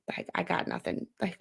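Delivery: breathy whisper, no voice; I got nothing under the breath; whispered aside. Real, not acted. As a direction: drop to a resigned whisper. Deflated aside after bad game.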